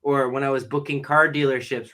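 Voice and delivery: ordinary talk, nothing marked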